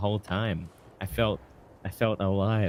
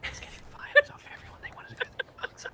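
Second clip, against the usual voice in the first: whispered correction; hushed whisper, barely voiced; 5 dB quieter, faster than usual. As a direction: drop to a hush, library style. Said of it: "you gotta speak up".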